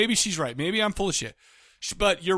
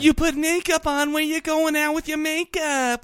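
high-pitched whiny nosy landlady; exaggerated, prying whiny voice; 9 semitones higher than usual. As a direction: nag in a pitched-up whine. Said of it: "she was whiny, and she's nosy".

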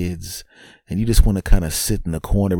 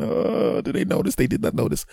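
high, airy, nervous squeak; anxious hush. Acted. Invisible dad checking if the kids noticed, anxious hushed squeak. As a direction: strain it small and high so nobody hears.

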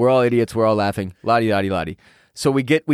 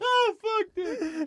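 laughing; 8 dB quieter, much slower than usual. Real, not acted. Laughing at the prank reveal.

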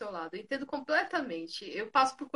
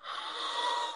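happy gasp; 7 dB quieter than usual. Retold or imitated. Hearing she passed the exam.

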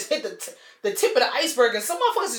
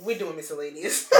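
ordinary talk, nothing marked

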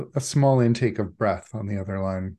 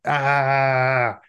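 long, loud, raised scream; 5 semitones higher, 7 dB louder than usual. Acted. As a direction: constant held "ah" scream. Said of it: "someone starts screaming in your ear".

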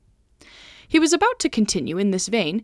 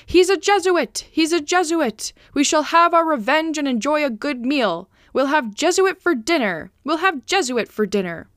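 higher, raised chant of a hungry mob; mob crying out; 5 semitones higher, 3 dB louder than usual. A narrator's reading. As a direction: cry it out like a crowd baying for dinner. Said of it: "the whole mob was crying".

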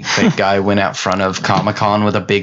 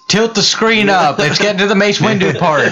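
a stranger on the plane begging to see the screen, higher and laughing; imitating a passenger; 11 semitones higher than usual. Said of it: "You just hear a person in the back".

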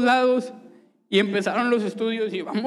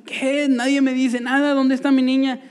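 higher and louder anguished outcry; father cries out in anguish, louder and higher; desperate crying out; 5 semitones higher, 4 dB louder than usual. Retold or imitated. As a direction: cry out for the missing baby. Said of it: "yo llorando".

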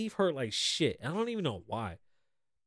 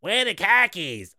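slow, raised mock American accent; super American accent; 5 semitones higher, 6 dB louder, slower than usual. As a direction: lightly ham up an all-American voice. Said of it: "Like, super American".

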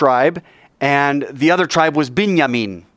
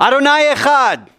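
with gusto; 12 semitones higher, 5 dB louder than usual. Shown as a demonstration. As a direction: lead the congregation in a full-voiced prayer call. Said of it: "say it with some gusto".

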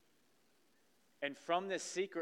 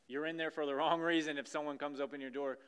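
usual speaking voice

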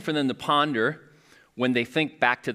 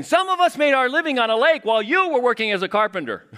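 much higher, louder indignant fisherman; Peter from The Chosen; 11 semitones higher, 6 dB louder than usual. Retold or imitated. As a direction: play Peter huffing at the carpenter, raised and high. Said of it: "I see Peter on The Chosen".

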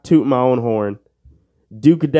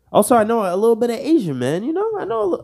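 cocky brag; 10 semitones higher, faster than usual. Acted. Boastful bit, fast and high.

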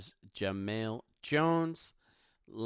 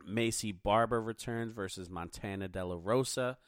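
ordinary talk, nothing marked

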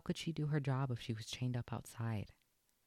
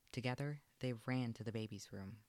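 usual speaking voice

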